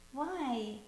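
soft, whiny protest. Real, not acted. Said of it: "You sound like a whiny teenager".

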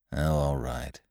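low, quiet, grudging consent; sullen, muted giving-in; uncordial agreement. A narrator's reading. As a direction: give in reluctantly, voice dropped. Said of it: "Not cordially, but he said it".

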